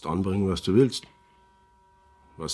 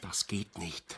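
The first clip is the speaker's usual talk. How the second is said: much softer, lower flat refusal; 7 semitones lower, 13 dB quieter, slower than usual. Acted. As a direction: shut it down under his breath. Er lehnt die Flucht ab. (leise, tiefe Ablehnung)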